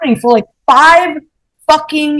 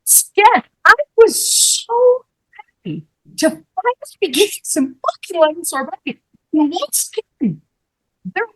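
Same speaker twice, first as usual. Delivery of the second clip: tearful whimper; 6 semitones higher, 4 dB quieter than usual. Retold or imitated. Diner recalling her lost lemon sorbet, tearful. Recounts meltdown in tiny high voice.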